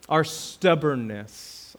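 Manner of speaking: ordinary delivery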